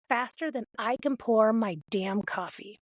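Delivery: usual speaking voice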